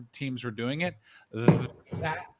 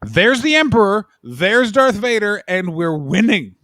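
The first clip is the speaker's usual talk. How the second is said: loud, higher, drawn-out gloating; mock triumphant cheer; 4 semitones higher, 6 dB louder, slower than usual. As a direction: crow like a fan of the villains. Acting out a gleeful Empire fan.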